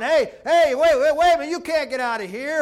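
loud, high alarmed yell; urgent hollered protest, easing at the end; Death yelling. Acted. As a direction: shout after someone who is escaping. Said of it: "you hear death yelling".

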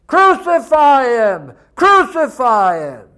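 loud, slow chanting of an angry crowd; mob yelling. Acted. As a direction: shout it like a furious mob. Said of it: "that crowd's yelling".

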